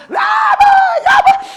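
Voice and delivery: put-on very high, loud child whine; kids whining. Preacher mimicking his kids calling mom, very high whine.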